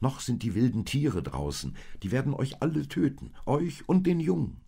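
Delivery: high, quickening, rising warning; alarmed warning. A narrator's reading. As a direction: warn them in growing alarm. Old man warns of wild beasts.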